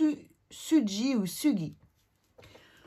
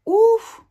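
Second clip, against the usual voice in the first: loud high puff of dismay; exclamation ouf; 14 semitones higher, 12 dB louder than usual. Real, not acted. Facing an English word she can't say, a loud high ouf. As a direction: blurt it out before a hard word.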